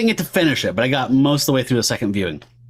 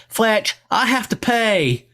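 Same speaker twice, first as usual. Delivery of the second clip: high, slow, hammed-up Southern drawl; mock bad Georgia accent; 8 semitones higher, much slower than usual. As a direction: parody an awful Georgia accent, pitched way up. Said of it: "her accent is insanely bad".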